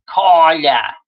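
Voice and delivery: mild Northeastern "Carla" with dropped r; a light Northeastern accent on "her name is Carla". Retold or imitated. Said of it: "doing the Northeastern accent".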